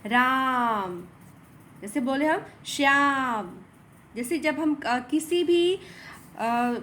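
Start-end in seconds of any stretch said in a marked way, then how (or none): 0.1-1.0 राम with its aa stretched; prolonged ram. Shown as a demonstration. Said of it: "दीर्घ स्वर से भी अधिक समय". 2.7-3.6 श्याम with the long aa drawn out; stretched shyam. Shown as a demonstration. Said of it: "word पर ज़्यादा जब हम लोग जोर देते हैं".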